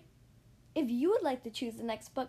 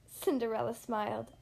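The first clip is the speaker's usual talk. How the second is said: ordinary delivery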